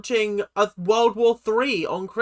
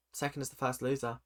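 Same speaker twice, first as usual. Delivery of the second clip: low, soft throwaway line; quiet aside; 5 semitones lower, 12 dB quieter than usual. Shown as a demonstration. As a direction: mutter it under your breath. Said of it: "say it with me".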